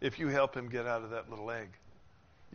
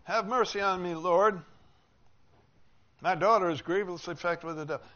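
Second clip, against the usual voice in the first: loud, high, desperate cry for mercy; desperate plea; 5 semitones higher, 10 dB louder than usual. Acted. Mother begging Jesus to heal her daughter, loud desperate plea. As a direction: beg loudly as a mother desperate for help. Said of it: "She was desperate".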